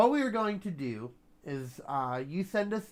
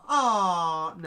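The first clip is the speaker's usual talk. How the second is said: disappointed aw; 2 semitones higher, 7 dB louder than usual. Retold or imitated. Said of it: "and I'll go".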